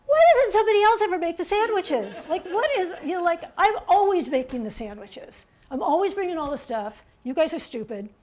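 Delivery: loud, high-pitched mock rant; exasperated outburst. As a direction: act out fed-up complaining at friends. Acted resentful outburst at friends.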